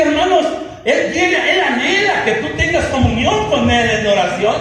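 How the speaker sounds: loud, driving sermon delivery; fiery preaching. Real, not acted. Pastor rebukes the flock for neglecting the Bible, fiery preaching. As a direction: preach it hard to the congregation.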